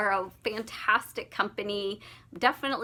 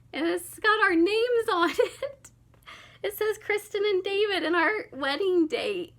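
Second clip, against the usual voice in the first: emotional gush; 7 semitones higher than usual. Real, not acted. Moved by wedding gift card.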